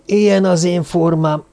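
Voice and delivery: mild lament. A narrator's reading. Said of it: "fohászkodott Karahan professzor".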